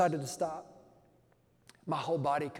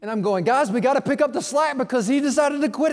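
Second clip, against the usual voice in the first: louder, higher, hammed-up complaint; mock yell; 9 semitones higher, 9 dB louder than usual. The back yelling at the preacher, loud mock complaint. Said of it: "my back is starting to yell at me".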